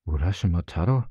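rising, disbelieving question; astonished. A narrator's reading. Old man hears the long-lost name, astonished. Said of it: "rief der Greis voller Erstaunen".

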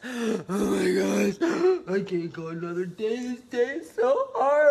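hammed-up whiny moaning about a hard day; mock self-pity. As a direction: overdo the poor-me complaining. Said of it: "think like this".

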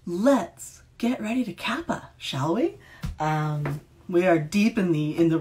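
high, slow, eager announcement; excited. Real, not acted. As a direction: hype up the start of reading. Announcing the reading.

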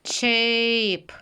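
"Cheap" with a clear ch; repeated model word. Shown as a demonstration. Teacher repeats the word for the class, clear ch. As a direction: say "Cheap" again, slowly and clearly, ch at the start. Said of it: "Ще раз".

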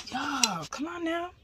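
exasperated. Real, not acted. Scolding the sliding tripod.

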